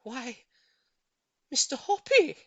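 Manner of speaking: a high, joyful exclamation; cried out. Acted. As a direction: Mrs Silver cries out in delight at the proposal. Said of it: "she cried".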